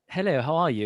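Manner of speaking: lightly put-on British accent; imitated British accent. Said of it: "he had this full-blown British accent".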